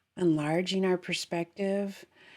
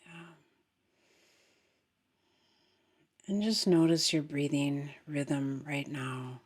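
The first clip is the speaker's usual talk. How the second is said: soft, unhurried meditation voice; guided meditation; 2 semitones lower, 4 dB quieter than usual. Acted. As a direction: guide them gently into the breath.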